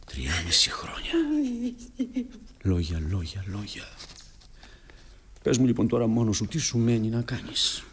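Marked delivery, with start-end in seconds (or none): 0.0-3.8 low, hushed, starting as a slow whisper; stunned murmur. Acted. Son absorbing years of empty words, stunned murmur. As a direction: let the words drop out low with long pauses.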